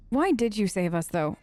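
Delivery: normal talking voice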